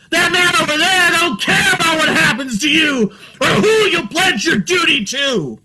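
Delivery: high, loud preaching shout; hollering. Retold or imitated. Man running away hollering at the people, fierce shout. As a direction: holler it at the crowd like a prophet. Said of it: "ran away hollering".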